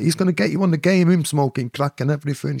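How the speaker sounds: fast, high, loud warning; urgent warning. Retold or imitated. Man warns girl again, fast high urgency. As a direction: rush the warning out.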